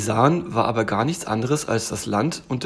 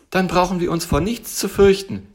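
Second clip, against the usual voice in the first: high, louder little tiger voice; relieved little tiger; 7 semitones higher, 5 dB louder than usual. Acted. Tiger trusts bear knows the way, relieved. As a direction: chirp it as a confident little tiger.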